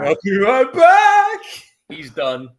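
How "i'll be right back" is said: high, very loud drawn-out holler; big hammed-up singsong yell; mock shout. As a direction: yell it slowly to tempt fate. Mockingly shouts the forbidden line.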